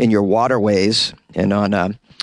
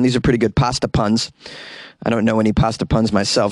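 ordinary delivery

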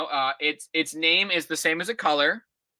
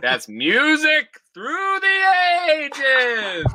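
slow, loud, drawn-out title announcement; grand finale; 11 semitones higher, 5 dB louder, much slower than usual. Pretend. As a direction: boom out the big ending like a finale. Grand closing line of essay.

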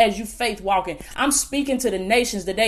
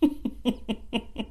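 quiet, broken chuckle; soft laugh; 8 dB quieter than usual. Real, not acted. Preacher recalling an empty bank account, soft laugh. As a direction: chuckle softly to oneself.

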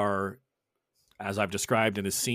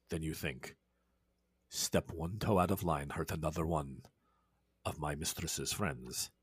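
menacing low vampire threat; 8 semitones lower, 12 dB quieter than usual. Acted. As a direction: whisper a death threat down at him. Edmundo threatens him.

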